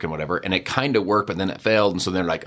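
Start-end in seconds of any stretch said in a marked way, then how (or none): none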